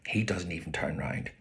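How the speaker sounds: soft unvoiced aside; whisper. A narrator's reading. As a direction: breathe it out as a sulky aside.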